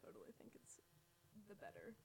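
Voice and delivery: whispering. Real, not acted. Unvoiced aside.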